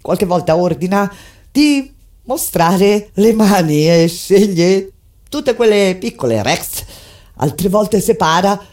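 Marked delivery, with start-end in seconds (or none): none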